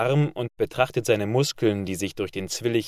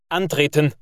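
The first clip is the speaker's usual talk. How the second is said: sharp, louder parade-ground call; called command; 5 semitones higher, 4 dB louder than usual. A narrator's reading. Sergeant calling the class to fall in, a loud call. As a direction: call the troops to line up. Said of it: "Er ruft".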